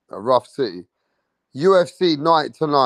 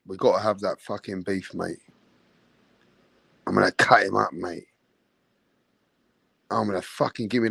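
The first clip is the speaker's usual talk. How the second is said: lower, quieter menacing roadman voice, hammed up; mock London gangster; 8 semitones lower, 5 dB quieter than usual. Streamer acting out a Top Boy gangster to chat, lower mock menace. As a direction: growl out threats like a Top Boy hard man.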